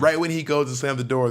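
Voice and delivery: ordinary talk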